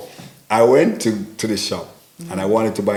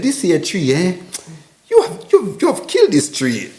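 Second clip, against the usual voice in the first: lightly voiced teasing shopkeeper, higher and louder; mock shopkeeper; 9 semitones higher, 3 dB louder than usual. Shopkeeper mocking his bad Twi, teasing. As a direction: tease him like the amused seller. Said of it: "She said".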